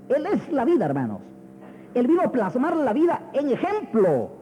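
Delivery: high-pitched, emphatic sermon declaration; preacher's ringing proclamation; forceful preaching. Acted.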